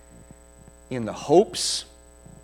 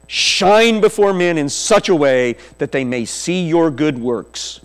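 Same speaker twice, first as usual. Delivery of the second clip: loud scripture proclamation, key words stretched; full-force preaching; 3 semitones higher, 9 dB louder than usual. Real, not acted. Preacher applying Jesus' teaching to the congregation, loud emphatic preaching. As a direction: proclaim it loudly to the whole room.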